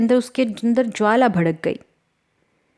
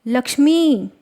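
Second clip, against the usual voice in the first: higher-pitched cry of alarm; 6 semitones higher, 4 dB louder, slower than usual. A narrator's reading. Elder sister calls after fleeing Lakshmi, crying out. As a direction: call out a name in a sudden cry. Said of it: "उसकी अक्का की चीख निकली".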